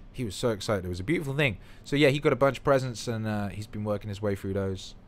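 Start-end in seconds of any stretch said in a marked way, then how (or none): none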